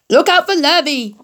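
high, loud warning call; calling cows. A narrator's reading. Cows warning Wilbur, high loud call. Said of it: "called the cows".

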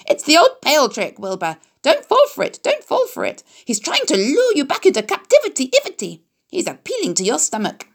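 very high, sing-song goose call; honking goose cry. A narrator's reading. Goose urging Wilbur to dodge, high honking cry. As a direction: squawk the orders like a flustered goose. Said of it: "said the goose".

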